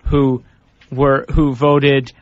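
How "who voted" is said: ordinary delivery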